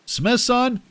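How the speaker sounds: high, desperate cry of a name; shouted name in panic. A narrator's reading. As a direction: call out his name in alarm. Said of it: "Enclair cried".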